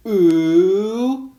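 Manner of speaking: high, loud, rising "ooh"; exclaimed ooh. Acted. Students reacting to a classmate in trouble, exclaiming. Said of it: "The students all exclaimed".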